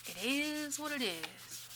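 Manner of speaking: slow, high mock-weary drawl; sarcastic. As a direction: say it like a tired cliché, rolling eyes. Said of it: "so I'm being sarcastic".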